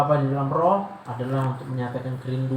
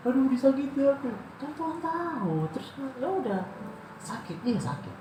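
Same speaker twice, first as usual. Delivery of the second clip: high whiny complaint; 11 semitones higher than usual. Acted. Mocking complaining prayer.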